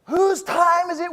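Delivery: high, slow, loud rally call; hype call. Shown as a demonstration. Speaker calling out to the crowd, loud, high and slow. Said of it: "I'm gonna say".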